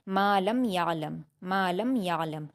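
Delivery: slow recitation, the meem of "लम" sounded clearly before "या"; clear unmerged meem. Shown as a demonstration. Teacher demonstrating the meem sakin rule, clearly articulated. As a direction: recite "मा लम या लम" slowly, keeping the meem distinct. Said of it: "मीम साकिन की आवाज़ को जाहिर".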